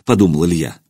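ordinary delivery, nothing marked

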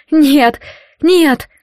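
high, loud desperate cry; desperate refusal. A narrator's reading. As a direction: cry out no in fear. Captive refusing in terror. (отчаянный крик)

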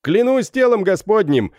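высоко и громко, последнее медленно; заорать божась; громкий ор. Acted. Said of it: "заорал капитан". (капитан орёт под дверью, божась)

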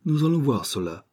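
put-on dry reply with an English accent. A narrator's reading. Gunman doubting the burglar's excuse, English accent. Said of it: "avec un fort accent anglais".

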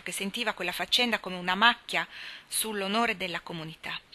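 usual speaking voice

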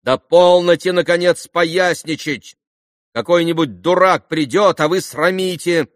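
high, loud scolding of her father; exasperated daughter, pitched high; disdainful outcry. A narrator's reading. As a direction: cry out in disgusted reproach. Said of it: "вскрикнула неожиданно девушка у окна, обращаясь к отцу с брезгливою".

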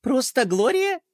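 high, scornful question; snorting. Acted. Princess Pinky sniffing at Gloria, scornful. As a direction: snort at the plain name. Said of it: "фыркнула Пинки".

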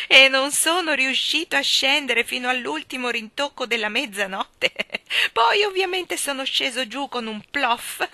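put-on an old man barely holding in giggles; giggly excited Mr Wigg. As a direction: Mr Wigg excitedly recounting his birthday float.

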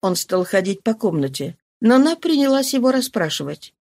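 ordinary delivery, nothing marked

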